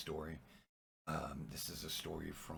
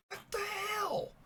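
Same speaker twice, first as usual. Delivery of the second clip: very high, drawn-out, exasperated shriek; hammed-up scream of disbelief, loud and slow; mock scream; 7 dB louder, slower than usual. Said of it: "He starts screaming again".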